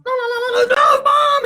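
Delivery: high trill bursting into a shout; frantic ringing and yelling. Retold or imitated. Teen keeps hanging up on the ringing phone, high frantic yell. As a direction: mimic the phone, then yell in protest.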